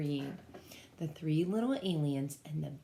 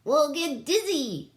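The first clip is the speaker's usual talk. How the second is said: high, loud protest; alarmed yell; 9 semitones higher, 7 dB louder than usual. Acted. Niklawixka yells at Gork on the ring, high and loud. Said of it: "yelled Niklawixka".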